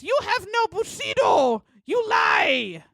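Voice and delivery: high shouted fake samurai accent; mock samurai shout. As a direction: bark it like a hammed-up samurai. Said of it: "And he says".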